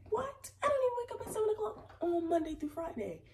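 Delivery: high, shrieking disbelief, half crying; outraged disbelief. Retold or imitated. Telling viewers about waking at seven, high outraged squeal. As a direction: squeal it like you can't believe it. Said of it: "I'm like".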